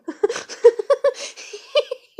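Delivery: high, loud burst of laughter; helpless high-pitched giggle; real laughter. Real, not acted. Woman laughing at whom she was dating, real laughter. Laughs at having dated him.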